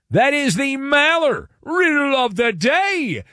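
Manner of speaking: big high announcer lift on the riddle; announcer build-up. Acted. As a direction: present it like a show segment. Names the show segment.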